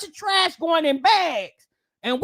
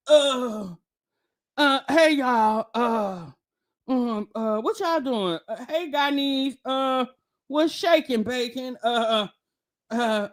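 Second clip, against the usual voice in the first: mocking Jasmine's stammer; 6 semitones lower, slower than usual. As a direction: mimic her fumbling greeting. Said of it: "That's how Jazz up here".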